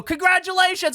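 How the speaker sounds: high shouted cheer; calling out congratulations. Retold or imitated. Onlookers to the newly engaged couple, calling out.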